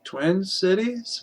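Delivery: slow, soft questioning echo; puzzled echo. Acted. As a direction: draw it out softly as a puzzled question. Acts out puzzled reply.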